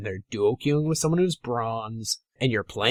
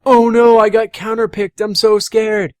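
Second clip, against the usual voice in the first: much higher and louder, hammed-up fright; mock scared whine; 8 semitones higher, 8 dB louder than usual. Coach mocking viewers afraid of counterpicks, hammed-up whine.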